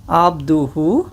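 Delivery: slow, syllable by syllable Arabic dictation; over-enunciate each Arabic word for repeating; said slowly. Shown as a demonstration. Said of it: "I will say this slowly".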